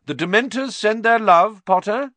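high, loud jeering shout across a crowd; shouted taunt. Acted. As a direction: sneer it out loud at him. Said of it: "who shouted through the crowd".

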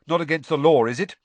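loud, cheeky retort; defiant Ron. Acted. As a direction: push back at Snape.

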